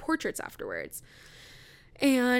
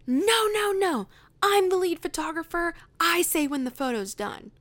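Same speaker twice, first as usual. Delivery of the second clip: higher, louder mock-indignant boss voice; bossy lead photographer; 9 semitones higher, 6 dB louder than usual. Lead photographer scolding her with a hammed-up indignant voice. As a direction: ham up a self-important boss.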